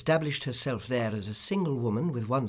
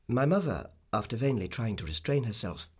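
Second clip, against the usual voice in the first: usual speaking voice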